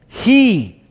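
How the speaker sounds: HE set apart, high and forceful; emphatic HE. Shown as a demonstration. Preacher showing the Hebrew emphasis, HE stressed. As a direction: punch HE high after a short pause. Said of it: "is emphasized in the Hebrew".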